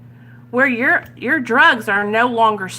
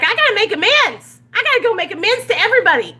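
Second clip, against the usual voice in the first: higher and fast, in a panicky rush; frantic urgency; 10 semitones higher, faster than usual. Retold or imitated. Newcomer three weeks sober rushing to make amends, frantic and fast. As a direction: blurt it like you can't wait a second.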